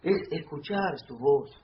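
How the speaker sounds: prédica fuerte y grave; loud preaching. Acted. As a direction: drive home God's desire with full force. Preaching at full force.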